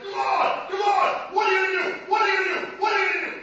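high-pitched imitated yelling; mock screaming mob. Preacher acting out the crowd pressing Jesus, screaming and yelling. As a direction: hammer it out as a high, shouted taunt. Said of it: "they're screaming and yelling".

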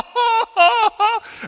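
a high, loud mock laugh of rapture; hammed-up holy laugh. A worshipper competing for holiness, with a loud mock laugh. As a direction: laugh as if overcome, just to win.

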